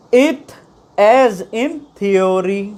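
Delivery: "Ith as in theory" spoken slowly, stretching each word; slow pronunciation demo. Shown as a demonstration. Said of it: "ठीक है".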